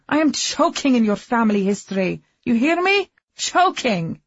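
loud, high desperate yelling; angry shouting. Acted. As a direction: scream it at him. Wife shouting at husband.

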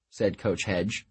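usual speaking voice

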